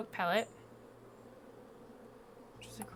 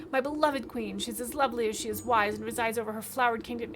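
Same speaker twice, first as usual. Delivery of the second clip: gushing beekeeper; 3 semitones higher, 7 dB louder than usual. Acted. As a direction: swoon over her. Beekeeper gushes about his queen.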